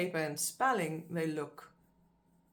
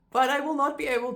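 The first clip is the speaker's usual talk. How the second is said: laughing; 9 semitones higher, 9 dB louder, faster than usual. Real, not acted.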